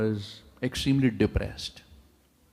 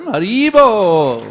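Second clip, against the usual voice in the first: long loud stretched call; joyful cheer; 8 semitones higher, 12 dB louder, much slower than usual. Real, not acted. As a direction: rouse the whole hall. Crowd cheer for a guest.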